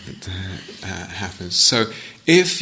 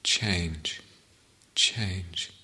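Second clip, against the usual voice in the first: guided meditation. Acted. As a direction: guide listeners gently inward, phrase by phrase, leaving silences.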